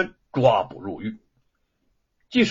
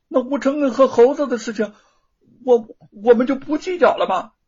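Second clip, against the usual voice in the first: high, loud, rushed protest; indignant protest; 9 semitones higher, 6 dB louder than usual. Acted. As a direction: press his father, voice up and fast. Son protests to his father.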